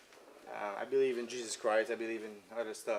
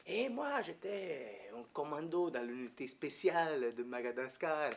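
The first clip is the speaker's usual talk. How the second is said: put-on priest's French accent; 4 dB quieter, slower than usual. Retired commando priest telling his past, imitated French accent. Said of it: "the way he talks with that French accent".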